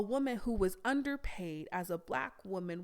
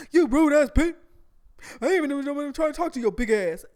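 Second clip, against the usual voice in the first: very loud, higher, shouting insults; angry yell; 6 semitones higher, 13 dB louder than usual. Retold or imitated. Stranger screaming insults back after being rejected, loud and furious. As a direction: hurl the insults full force like an enraged man. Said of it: "He was like".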